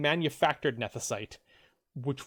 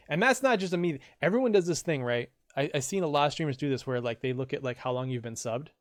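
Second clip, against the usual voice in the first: ordinary delivery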